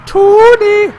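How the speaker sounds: very high, drawn-out call; spooky high cry; eerie call. Acted. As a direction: call the name like a ghost. Creepy call.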